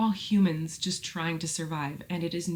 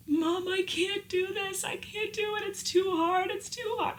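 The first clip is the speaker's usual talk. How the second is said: retold bawling. Calling mom in the middle of the night, bawling. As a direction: imitate bawling down the phone, very high. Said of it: "and just bawling".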